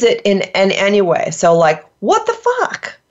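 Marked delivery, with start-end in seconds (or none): 2.0-3.0 high-pitched, astonished outburst; incredulous exclamation. Shown as a demonstration. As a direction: toss it up high in mock disbelief. Demonstrates incredulous example phrase.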